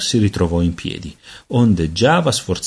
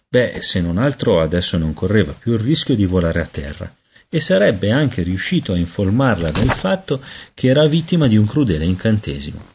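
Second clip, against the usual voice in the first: usual speaking voice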